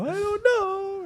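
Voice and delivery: very high, drawn-out mock wail; hammed-up little-kid crying voice; whiny little kid. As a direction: stretch it out like a bawling child. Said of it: "I was like a little kid".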